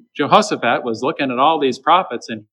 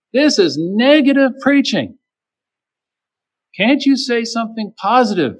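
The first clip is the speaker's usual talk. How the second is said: mock complaining critics; 9 semitones higher than usual. Critics complaining about preaching.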